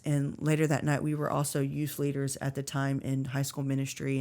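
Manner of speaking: ordinary talk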